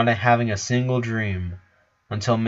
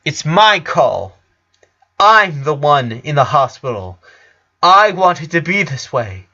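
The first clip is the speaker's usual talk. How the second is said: insistent; 4 semitones higher, 6 dB louder than usual. A narrator's reading. Sick boy insisting his sisters obey, stubborn and loud. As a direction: plant your feet on it.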